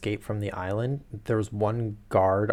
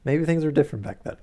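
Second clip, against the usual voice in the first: wry aside; 6 semitones higher, 5 dB louder, faster than usual. Real, not acted.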